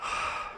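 soft breath out; resigned sigh. A narrator's reading. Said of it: "Mama sighed".

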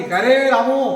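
loud call across the house; calling out to servant. Acted. Master calls servant Ramu at night, loud call. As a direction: call the servant sharply by name.

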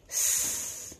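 S as a soft, long voiceless hiss; phonics sound demo. Shown as a demonstration. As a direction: hold "S" softly while drawing. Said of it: "writing the s sound".

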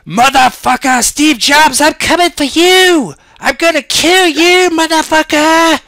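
loud, high-pitched battle scream; screaming threat. Acted. Armed Bill Gates hunts Steve Jobs, screaming. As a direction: scream it out like a gunman charging in. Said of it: "he screams out".

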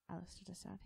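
usual speaking voice

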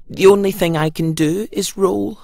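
a light hint of a hopeful pumpkin; Pumpkin's voice. A narrator's reading. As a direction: ask shyly like a little pumpkin. Pumpkin explains.

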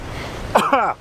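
short sharp cough; clearing a fly from the throat; just swallowed a fly. Real, not acted. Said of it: "I think I just swallowed a fly".

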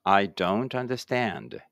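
slow, carefully spaced English for the class to repeat; slow model reading. Shown as a demonstration. Teacher models English for pupils reading aloud together, slow and clear. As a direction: say each word slowly and clearly, like a teacher modeling. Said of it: "一緒に声出してください".

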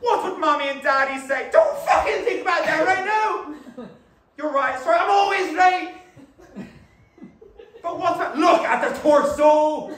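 high, loud bickering between inner voices; shouted argument. Acted. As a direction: snap back and forth in a heated squabble. Inner voices argue loudly.

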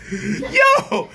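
high laughing cry of yo. Real, not acted. Still laughing at the protesters, cracking up. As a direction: crack up on the word.

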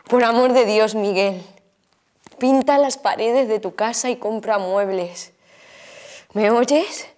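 súplica enfática, con la voz bien alzada; ríñele en voz alta, con insistencia; regañina cariñosa. Acted. Regaña a Miguel en su despedida. (regañina en voz alta)

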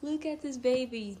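high, laughing fluster; high and giggly, tumbling into the reveal; giddy excitement. Real, not acted. Giddy before revealing the doll.

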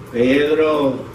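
put-on low Puerto Rican-accented name. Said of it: "that much Puerto Rican accent".

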